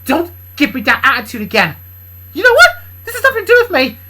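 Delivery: loud, high scolding character voice; angry Kendra. Acted. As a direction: scold him hard, voice up high.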